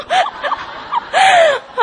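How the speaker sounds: loud, high mock wail; exaggerated crying howl, half laughing; mock sobbing. Re-enacting her tearful protest to leader.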